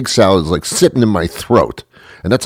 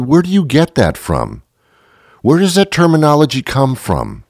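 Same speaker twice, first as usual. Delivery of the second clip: ordinary talk, nothing marked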